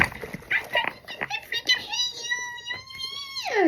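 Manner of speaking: very high, drawn-out squeal; mock meltdown in a tiny high squeal; crashing out. Stuck girl crashing out at onlookers, very high mock squeal. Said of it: "I was crashing out".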